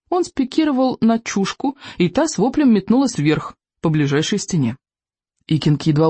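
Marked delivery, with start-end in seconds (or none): none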